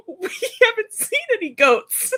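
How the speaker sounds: high, slow, laughing; laughing quote. Retold or imitated. Aunt quoting her sister-in-law while laughing. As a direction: deliver through laughter. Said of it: "my sister-in-law goes".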